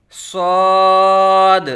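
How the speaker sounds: thick, heavy صاد held long and loud; full-mouthed whistling Saad. Shown as a demonstration. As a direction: round out صاد with a whistle-sharp s. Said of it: "پر یعنی موٹا کر کے پڑھیں گے".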